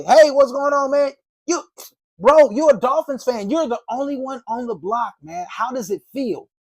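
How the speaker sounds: light him up like a pal; energetic buddy greeting. Shown as a demonstration. Salesman showing the lively opener to a Dolphins fan, energetic. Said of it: "But if I go to that person".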